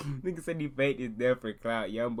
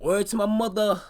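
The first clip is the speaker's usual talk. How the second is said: put-on loud, high New Jersey-accent slang; mock New Jersey accent; 11 semitones higher, 9 dB louder than usual. Mocking the rapper's New Jersey accent, loud. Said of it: "I don't know if I like them accents".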